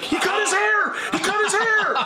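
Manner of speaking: very high-pitched imitation of an astonished crowd; mock crowd cry. Vegas crowd thinking he is Bolton, mock astonished squeal. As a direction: squeal it as the fooled fans. Fooled crowd reacting to singer.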